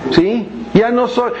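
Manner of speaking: normal talking voice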